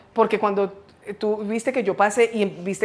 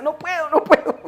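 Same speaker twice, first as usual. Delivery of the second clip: higher-pitched urgent pleading; retold plea for help; 8 semitones higher, 4 dB louder than usual. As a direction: act out being stuck and pleading. Retells pleading while stuck.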